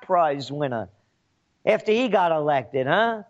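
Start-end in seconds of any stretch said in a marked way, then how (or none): none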